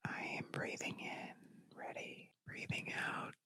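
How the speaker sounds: soft breathing whisper, slow. Shown as a demonstration. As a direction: whisper the breath cues gently. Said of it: "I did a better version".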